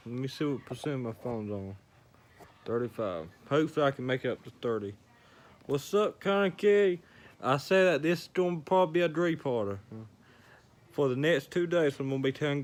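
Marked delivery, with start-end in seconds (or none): 5.7-7.0 excited greeting. Real, not acted. Greeting a viewer joining.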